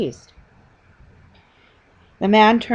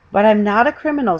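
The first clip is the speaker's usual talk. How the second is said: hotly; 4 semitones higher, 8 dB louder, faster than usual. A narrator's reading. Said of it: "said Paddington hotly".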